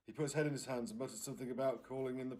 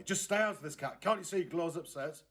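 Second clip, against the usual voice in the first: a mum snapping at her daughter, raised pitch; sharp telling-off; 5 semitones higher, 5 dB louder than usual. Acted. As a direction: shoo the kid away, annoyed.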